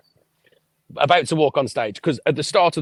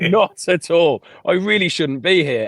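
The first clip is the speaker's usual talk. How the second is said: higher, louder panicked reply; nervous audition panic, pitched up; nervous squeak; 5 semitones higher, 5 dB louder than usual. Retold or imitated. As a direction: blurt it out like a scared kid.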